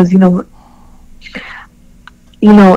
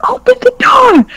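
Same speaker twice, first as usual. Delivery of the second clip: very high-pitched demand; retold angry yell. Woman at the locked bathroom door, retold angry yell. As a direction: re-enact yelling through a locked door.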